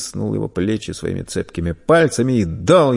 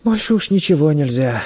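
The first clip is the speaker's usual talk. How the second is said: put-on high, resigned child's voice; small boy giving up; sighing; 5 semitones higher than usual. A narrator's reading. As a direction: sigh it out regretfully. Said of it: "вздохнул мальчик".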